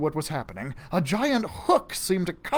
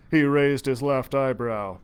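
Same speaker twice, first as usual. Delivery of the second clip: ordinary delivery, nothing marked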